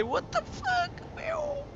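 very high, slow crying shriek; shrieking in panic. Real, not acted. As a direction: let it out as a long wailing scream of panic. Ship burning up in atmosphere.

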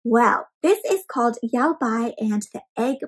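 usual speaking voice

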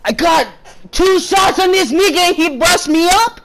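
high, exasperated gamer outburst; real high-pitched frustrated shouting; frustrated yelling. Real, not acted. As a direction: yell in outrage at the kill. Frustrated outbursts at being killed.

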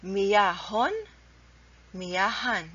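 مياه said "miyahun", then مياهان "miyahan", h sounded and no t; correct tanween endings. Shown as a demonstration. Teacher adds tanween to the word, مياه pronounced "miyahun". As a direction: add -un and -an straight after the h of مياه. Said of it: "That's the right way to pronounce it".

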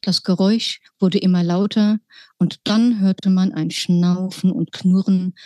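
usual speaking voice

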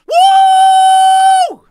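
high, loud whooping cheer; ecstatic woo. Real, not acted. Streamer whooping to the boys in celebration, ecstatic woo. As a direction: let out a huge woo.

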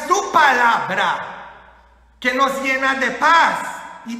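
loud, high, slow emphatic exhortation; forceful preacher's charge; fired-up preaching. Real, not acted. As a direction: hammer each phrase to the congregation.

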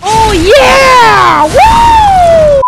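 loud high yell of triumph; excited whoop. Real, not acted. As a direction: whoop with joy. Celebrating in the game.

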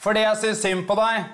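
retold heckle, called up high; heckler calling out loud. Heckler woman calling out to the comic, mock loud. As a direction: pitch it up like a woman heckling from the crowd. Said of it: "Og så sier hun høyt".

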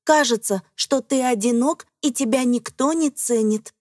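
ordinary delivery

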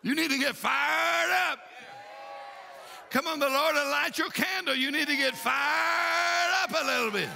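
high-pitched exhortation with stretched-out "fired"; fired-up preaching. Real, not acted. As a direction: rouse the congregation, drawing words out. Exhorts the congregation.

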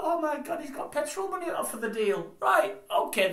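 high, stunned disbelief; incredulous. Retold or imitated. Presenter hears about petrol money, stunned disbelief. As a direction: gasp it out like you can't believe it.

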